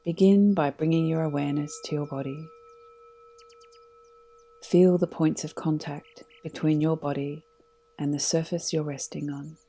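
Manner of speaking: calm, unhurried meditation guide voice; guided meditation. Acted. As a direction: lead them gently into stillness.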